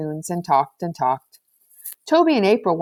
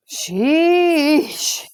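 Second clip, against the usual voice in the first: higher, louder fed-up groan of a word; exasperated moan; 9 semitones higher, 4 dB louder than usual. Acted. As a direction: let it out like a groaning sigh. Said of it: "Ken moaned all of a sudden".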